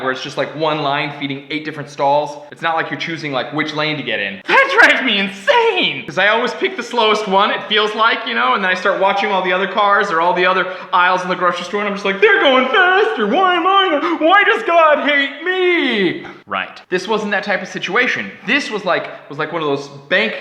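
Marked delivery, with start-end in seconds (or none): 4.5-6.0 exasperated. Real, not acted. Exasperated rant about queues. 12.2-16.3 high, loud whining self-pity; mock despair watching other queues move faster; whiny panic. Self in slow grocery line.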